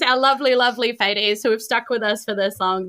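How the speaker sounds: usual speaking voice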